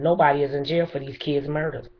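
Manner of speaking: ordinary delivery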